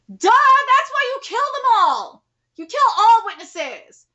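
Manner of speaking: loud, slow, high, hammering the point; a real rant, slow and loud; exasperated shout. Real, not acted. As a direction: lecture the characters, furious. Reader rants at the characters.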